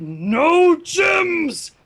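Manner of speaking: No gems shouted high; shouted note. Acted. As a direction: bark it like an angry order.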